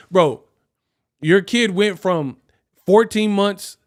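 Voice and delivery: ordinary talk, nothing marked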